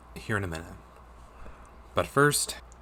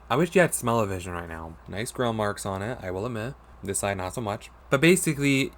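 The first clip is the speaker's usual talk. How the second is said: normal talking voice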